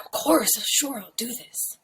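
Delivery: breathy whispered agreement; hushed promise. Retold or imitated. Promising a dying friend to read his letter, whispered.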